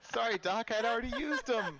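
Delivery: high, loud, through laughter; laughing apology. Real, not acted. DM apologizing to Doc, laughing hard. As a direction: apologize while cracking up.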